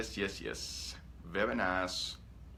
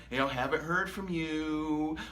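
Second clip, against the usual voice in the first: slow whiny mock voice; mock the clingy check-in message; mock needy text; 3 semitones higher, 4 dB quieter, slower than usual. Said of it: "So I'm not sending you the".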